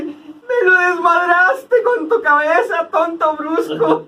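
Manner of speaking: high, slow, whining mock sob; mock crying old lady. Friend parodying the señora's umbrella complaint, whining. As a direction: whine it out like a wronged old lady.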